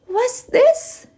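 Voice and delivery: put-on high, childlike "What's this?"; a small boy asking in wonder; little boy voice, curious; little boy's surprise. Little boy finds new book.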